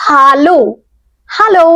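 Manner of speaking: হ্যালো with an open German a, as in আ; হ্যালো pronounced the German way with a clear open a; German hallo. Shown as a demonstration. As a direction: say হ্যালো with a wide open আ sound, not English hello. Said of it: "একদম আ".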